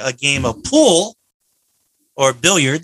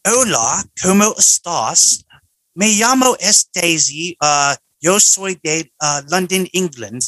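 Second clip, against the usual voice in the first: Spanish spoken in a London English accent; mock English tourist's Spanish; 4 semitones higher than usual. Shown as a demonstration. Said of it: "Espanol with the English".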